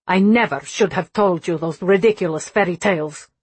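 put-on sharp Russian accent full of anger; angry Russian accent. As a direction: scold in a cutting Russian accent. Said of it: "her Russian accent sharp with anger".

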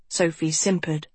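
plain reading voice

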